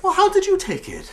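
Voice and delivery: high, loud, strained question; squeaky, astonished questioning; frantic disbelief. Acted. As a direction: ask it in a fluster. Father frantically questions mother.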